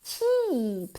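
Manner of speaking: "Cheap" with a very long ee, falling. Shown as a demonstration. Teacher modelling for learners, drawn-out "Cheap". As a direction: stretch the vowel of "Cheap" right out. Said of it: "con una I larga".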